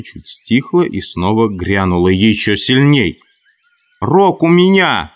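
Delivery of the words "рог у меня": high-pitched insistent yell; strained high cry over a jeering crowd. A narrator's reading. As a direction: demand the floor, pitch jumping up. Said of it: "Хрюша орал".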